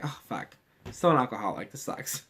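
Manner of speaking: very soft, barely voiced grumble; defeated mutter. The hero finding she still drinks, a defeated mutter. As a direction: sigh it out under your breath. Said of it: "she's just like".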